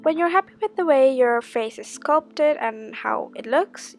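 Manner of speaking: ordinary delivery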